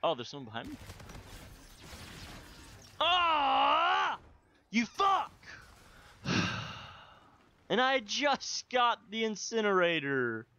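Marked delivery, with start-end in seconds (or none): 3.0-5.3 raised-pitch yelp into a curse; startled angry cry. Real, not acted. Player cursing an opponent in game, angry cry. 6.2-6.8 long voiceless exhale; exasperated sigh. Real, not acted. Player after a game setback, exasperated sigh.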